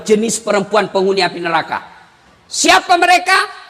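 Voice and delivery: ordinary delivery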